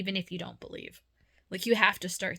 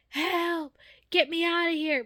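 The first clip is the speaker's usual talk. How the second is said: high, loud panicked shout; frantic yell for help; 11 semitones higher, 6 dB louder than usual. Retold or imitated. People trapped behind the curtain screaming to be let out, high and loud. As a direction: yell like someone trapped and desperate. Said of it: "they were yelling".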